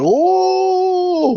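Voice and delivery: very high long gasp; shocked gasp. Retold or imitated. Viewer gasps at the Zhao reveal, very high. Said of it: "You're like".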